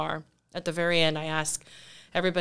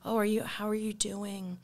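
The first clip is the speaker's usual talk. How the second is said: soft, higher check-in on a friend; mock concern; 4 semitones higher, 5 dB quieter than usual. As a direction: gently ask with a head-tilt of concern. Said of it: "Tilting the head, yeah".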